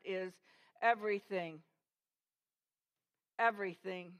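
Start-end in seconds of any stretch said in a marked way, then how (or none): none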